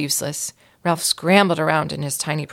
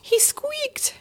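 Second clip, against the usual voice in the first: breathless, much higher whisper of wonder; marveling; 3 dB quieter than usual. A narrator's reading. Betty marvels to Mary Lou that the mouse spoke, in a high whisper. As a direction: gasp it out in amazement. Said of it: "marveled Betty".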